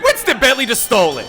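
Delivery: high, loud stunned announcement; excited call. Real, not acted. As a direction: shout the upset win. Wild finish, commentator erupts.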